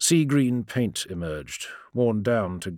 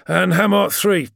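loud, high, exasperated finish; angry; 10 semitones higher, 8 dB louder than usual. A narrator's reading. Sergeant ending a long report to the warrior, angry. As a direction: force it out through gritted teeth. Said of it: "angry breaths soaring through his gritted teeth".

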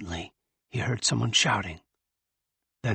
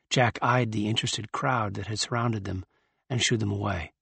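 ordinary delivery

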